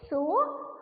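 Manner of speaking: usual speaking voice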